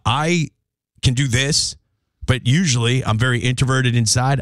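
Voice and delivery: ordinary talk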